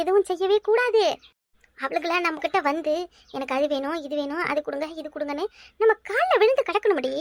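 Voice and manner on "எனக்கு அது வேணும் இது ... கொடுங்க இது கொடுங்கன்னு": softer, lower, pleading; quiet imitation of a pleading daughter-in-law; whiny begging daughter-in-law. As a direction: beg like a needy daughter-in-law.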